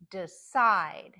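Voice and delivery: Decide with a reduced de and loud SIDE; careful model of Decide, weak start, strong end; last-syllable stress. Shown as a demonstration. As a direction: punch the second syllable of Decide. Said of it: "words where the last syllable is".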